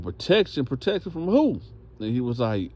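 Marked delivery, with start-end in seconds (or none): 0.0-1.6 higher-pitched mock disbelief; incredulous questioning. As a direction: throw it back as a puzzled question. Inmates question his plea.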